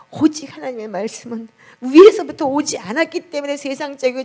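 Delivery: high, loud impassioned sermon voice; fervent preaching. Acted. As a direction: preach it with full fervor. Preaching at full force. (높고 힘찬 열정적인 설교)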